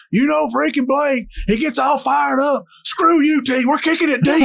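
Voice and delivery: mock coach. Coach voiced on headset.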